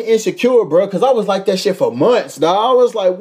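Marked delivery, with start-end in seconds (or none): none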